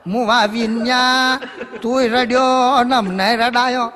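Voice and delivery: put-on slow, loud, high old woman's scolding; angry scolding. Diwali ba cursing Vinu for making them cry, slow loud scolding. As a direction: scold him like a furious granny.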